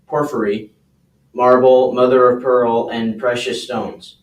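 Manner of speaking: scripture reading. Real, not acted. Volunteer reads verses to the class, measured scripture reading. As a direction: read the Bible passage out to the group.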